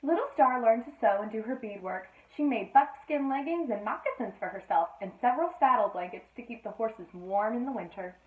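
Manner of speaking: lively read-aloud storytelling voice; storybook reading. A narrator's reading. Mother reading a bedtime story to Sophie, lively. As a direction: read the tale to a small child.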